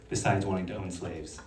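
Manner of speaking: ordinary delivery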